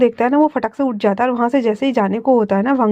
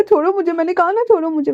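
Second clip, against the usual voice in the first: higher, upset and insistent; tearful refusal; 6 semitones higher than usual. A narrator's reading. Wing shrugging off Wangji's hands, high and tearful. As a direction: push him away once more. Wing pulls away again.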